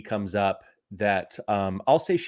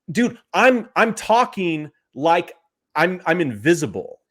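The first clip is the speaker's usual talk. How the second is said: loud, incredulous complaint; exasperated; 6 semitones higher, 4 dB louder than usual. Real, not acted. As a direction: drag out each word in exasperation. Exasperated at being ignored by saleswoman.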